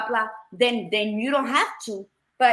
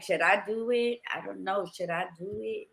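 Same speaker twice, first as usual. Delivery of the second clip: hesitant doubt; 2 semitones lower, 6 dB quieter than usual. Pretend. Voicing your own hesitant doubt.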